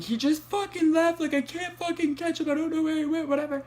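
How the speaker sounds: high, strained, tearful pleading; upset crying plea. Retold or imitated. Calling the dispatcher back after the driver fled, tearful. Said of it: "I call her and I'm, like, crying".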